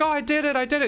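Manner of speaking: high-pitched mock celebration, quick and proud; hammed-up high girlish triumph; excited girlfriend. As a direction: imitate a girlfriend cheering her own win. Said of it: "she wins the fight, and she's like".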